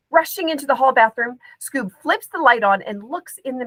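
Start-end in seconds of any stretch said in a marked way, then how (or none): none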